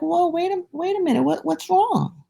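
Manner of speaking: high, puzzled landowner; taken aback by the grumbling; lightly voiced puzzled landowner; surprised landowner.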